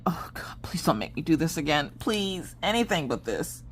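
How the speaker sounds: hushed, pleading under the breath; desperate whispered plea. Retold or imitated. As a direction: beg softly to be spared. Retold private desperate plea.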